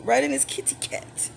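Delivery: whispered, teasing. Real, not acted. Whispering to the rival, sly and teasing.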